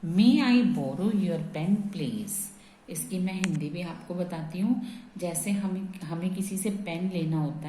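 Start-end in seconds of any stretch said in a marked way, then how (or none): 0.1-2.3 slow, word by word for students; slow dictation. Shown as a demonstration. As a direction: dictate each word slowly, stretching "May". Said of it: "मेरा next sentence है". (धीमी गति से शब्द-दर-शब्द बोलना)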